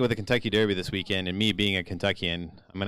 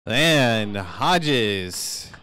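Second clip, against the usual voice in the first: live play-by-play; 3 semitones higher than usual. Real, not acted. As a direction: race announcer building to the finish. Calls the race live.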